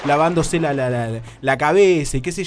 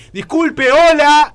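very loud, high yell of hello; shouting; 10 semitones higher, 10 dB louder, much slower than usual. Retold or imitated. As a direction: yell to be heard over the running water. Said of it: "empecé a gritar básicamente". (gritando desesperado por encima de la ducha)

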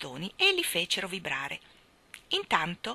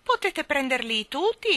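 lightly voiced squawky old woman; a hint of the cackling old lady; 7 semitones higher, 5 dB louder than usual. Miss Calico offering the children the sticks, lightly voiced. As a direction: lightly keep the hen cackle.

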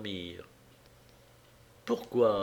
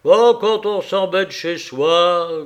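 slow, louder growled grumble; louder, slow grumbling husband; 5 semitones higher, 7 dB louder, slower than usual. Acted. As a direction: grumble it out resentfully. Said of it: "grogna-t-il".

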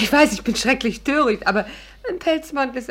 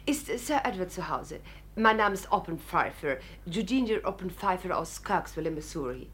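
put-on American lady; 7 dB quieter than usual. [name] plays Mrs Oppenpfeifer.

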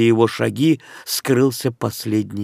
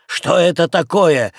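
сердитый окрик; 6 semitones higher, 3 dB louder than usual. Acted. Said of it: "кричал сквозь дверь хозяин".

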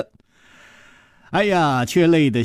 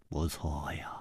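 hushed agreement; 11 semitones lower, 17 dB quieter than usual. A narrator's reading. Li Yuhan answers Chu Liuxiang.

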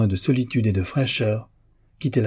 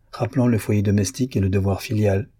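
usual speaking voice

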